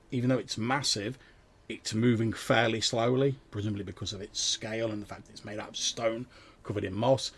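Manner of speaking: ordinary talk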